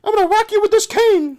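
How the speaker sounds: very high, loud mock threat; imitated squeaky doll-play quarrel. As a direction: threaten comically in cartoon falsetto. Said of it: "Auntie Gloria".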